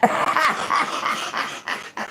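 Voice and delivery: long high breathy cackle; real laughter. Real, not acted. Mocking someone, bursts out laughing.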